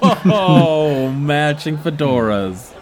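high, loud, drawn-out delight; slow, gleeful "oh"; delighted gushing. Real, not acted. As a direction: coo over it, pitched well up. Game master delighted by matching hats.

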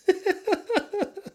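high-pitched giggling fit; laughing. Real, not acted. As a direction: giggle away at your own joke. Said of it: "when I'm laughing".

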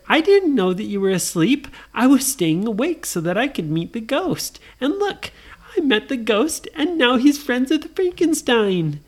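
put-on high-pitched girl defending herself; high, eager child character; excited Nora. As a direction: pitch it up and burst with news. Nora answers her brothers.

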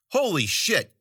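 high-pitched exasperated outburst; snapped. A narrator's reading. Zoot losing patience with the clueless crowd, snapping. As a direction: snap in exasperation. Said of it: "he snapped".

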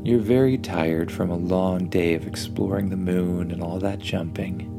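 ordinary delivery

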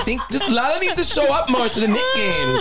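high, laughing, half shouting. Acted. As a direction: crack up while shouting it. Joking prediction, laughing shout.

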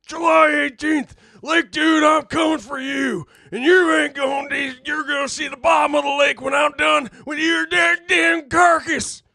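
shouted high wrestling promo. Pretend. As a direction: yell the promo to hype the fans. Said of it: "come up with a scenario".

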